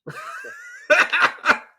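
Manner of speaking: high shrieking laugh, real and helpless; loud burst of laughter. Real, not acted. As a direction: crack up loudly. Big laugh at crude line.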